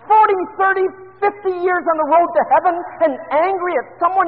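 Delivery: loud, high pleading sermon push; fervent preaching. Acted. Preacher pressing his flock about old bitterness, loud and fervent. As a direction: drive the challenge home to the congregation.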